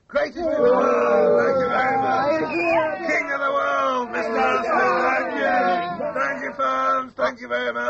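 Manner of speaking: slow, loud showman boasting; boastful shout. Acted. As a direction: bellow like a champion to the crowd. Boasting villain character to fans.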